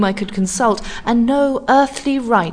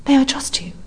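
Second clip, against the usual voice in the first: whisper; 6 semitones higher, faster than usual. A narrator's reading. Woman asks in whisper.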